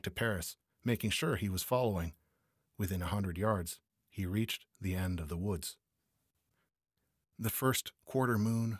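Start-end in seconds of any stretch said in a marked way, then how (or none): none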